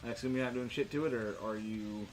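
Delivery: usual speaking voice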